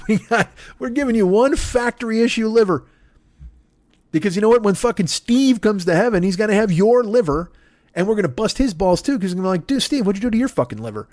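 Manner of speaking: high-pitched exasperated rant; ranting Jesus. Acted. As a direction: scold everyone like a fed-up Jesus.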